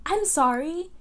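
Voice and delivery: mock theatrical apology; dramatic apology. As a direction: play the boyfriend's overdone apology. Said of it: "He was being very dramatic".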